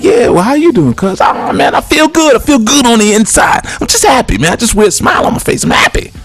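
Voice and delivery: high, sugary over-friendly greeting voice; mock Southern friendliness. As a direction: play the sneaky Southerner all smiles. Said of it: "They be like".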